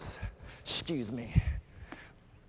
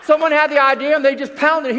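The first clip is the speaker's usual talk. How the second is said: animated retelling; 7 semitones higher, 7 dB louder than usual. Retold or imitated. Retelling meeting attack, animated.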